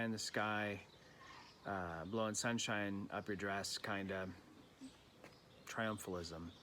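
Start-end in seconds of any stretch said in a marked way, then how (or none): none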